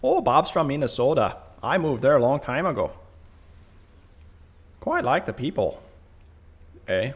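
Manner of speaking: folksy upper Midwest accent, played up; Minnesota accent. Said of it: "like, Minnesota".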